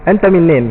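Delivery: Anta min nin as one clipped run, the last two words merged; Arabic street phrase. Shown as a demonstration. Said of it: "cuba test".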